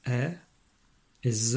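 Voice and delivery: "e" as an open è, then a buzzed "z"; "e", pause, "z", each sound alone; isolated phonemes. Shown as a demonstration. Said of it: "tous les sons qu'on entend".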